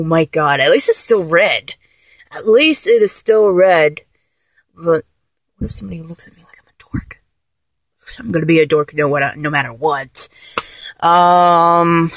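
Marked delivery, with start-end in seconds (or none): 4.7-7.2 fast, hushed, voiceless worry; anxious whisper. Acted. Fretting about the new haircut, a quick anxious whisper. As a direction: rush the fear out under your breath.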